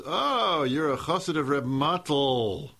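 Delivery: a high, loud "Oh," then a slow, knowing tease; mock surprise. Hitchhiker needling the proud chassid, in a slow, cheeky tease. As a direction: needle him by using the rebbe's pet name. Hitchhiker teasing the rich man.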